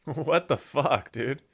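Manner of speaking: ordinary delivery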